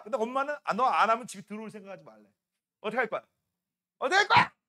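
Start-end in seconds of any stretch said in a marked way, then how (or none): 4.0-4.5 high, loud mock-threatening question; mock scary demand. Teacher teasing a scared class, high and loud mock threat. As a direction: loom over them with the question, playfully scary. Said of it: "너무 무서워하잖아".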